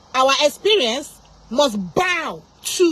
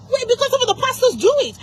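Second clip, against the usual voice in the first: high-pitched, indignant explanation; worked-up, high and insistent; heated accusation; 9 semitones higher, 3 dB louder than usual. Real, not acted. Heated accusation against pastors.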